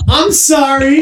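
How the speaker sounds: high, rising apology; retold helpless apology. Telling how he apologized to friend, helpless.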